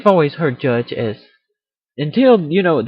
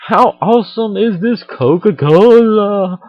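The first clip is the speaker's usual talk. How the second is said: mock excitement; 5 semitones higher, 7 dB louder, slower than usual. Judging a Coca-Cola.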